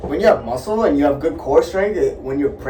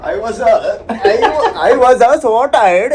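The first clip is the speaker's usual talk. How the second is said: loud, high mock-Indian accent, stumbling; attempted Indian accent; 6 semitones higher, 6 dB louder, slower than usual. Shown as a demonstration. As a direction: stumble through an Indian accent, half laughing. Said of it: "trying to speak in Indian accent".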